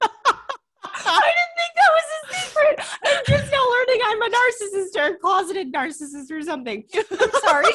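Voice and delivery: loud, high laughing protest. Real, not acted. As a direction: crack up while defending yourself. Laughing hard while protesting.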